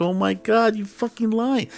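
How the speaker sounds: high-pitched retold disbelief; incredulous outburst. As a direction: squeal it like you can't believe the lie. Retold phone fight with girlfriend.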